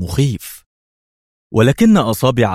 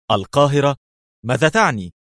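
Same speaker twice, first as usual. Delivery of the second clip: high, startled objection; shocked by the idea; surprised protest; 5 semitones higher than usual. A narrator's reading. Mona objecting to being sent home, high startled. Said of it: "في مزيج من الدهشة والاستنكار".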